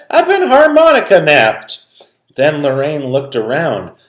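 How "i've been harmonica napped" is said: high, loud indignant cry; Papa discovering the theft, high and loud; dismayed Papa. Acted.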